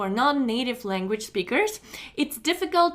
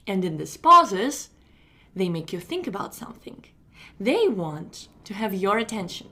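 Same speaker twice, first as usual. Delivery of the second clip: TV presenter delivery; 4 semitones lower than usual. Shown as a demonstration. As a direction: hold attention with measured phrases and pauses. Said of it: "try to say like they do".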